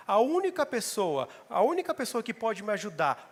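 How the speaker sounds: preacher voicing a desperate plea for help; impassioned preaching. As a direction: cry out to God as the one who can help. Preacher voices David's plea in cave.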